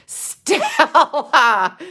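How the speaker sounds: loud, high, drawn-out wail; hammed-up cry of the name; mock dramatic call. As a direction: holler it like an overblown stage cry. Calls out Stella in mock despair.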